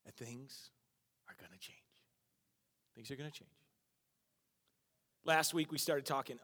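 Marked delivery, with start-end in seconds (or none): 0.1-1.8 tender hush. Real, not acted. Sermon encouragement. 2.9-3.5 soft promise; gentle reassurance. Real, not acted. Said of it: "I wanna encourage you today".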